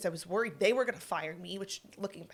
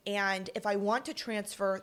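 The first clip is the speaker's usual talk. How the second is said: normal talking voice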